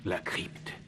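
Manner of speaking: slow hushed whisper; whispering so no guard hears. Acted. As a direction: breathe the words, sneaking in the dark. Sneaking alone through the crypt.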